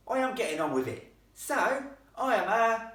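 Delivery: loud, high announcement with pauses; rough-accented lad's dramatic reveal; rough boy ghost. Acted. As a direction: announce yourself impatiently, holding back the last word. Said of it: "His accent was infinitely rougher".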